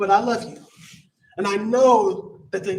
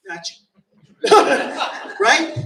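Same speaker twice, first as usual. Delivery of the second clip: teasing laugh; 5 semitones higher, 5 dB louder, much slower than usual. Real, not acted. Teasing aside to one member.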